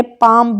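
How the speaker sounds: पाम with a silent L; long vowel straight into M in पाम; correct pronunciation. Shown as a demonstration. Teacher giving the fix, पाम with no L. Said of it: "पढ़ते हैं हम इसको".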